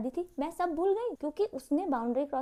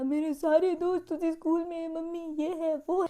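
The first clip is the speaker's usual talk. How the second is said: mock crying whine; 3 semitones higher than usual. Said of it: "मैंने रोना शुरू कर दिया".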